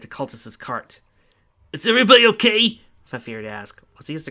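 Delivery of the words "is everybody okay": higher, louder check-in shout; loud call. Acted. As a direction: shout to the others after the crash. Fafnir asks after the crash.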